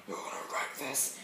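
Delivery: soft, unvoiced superhero rasp; Batman. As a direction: drop into a hushed, whispery hero growl. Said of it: "As Batman said".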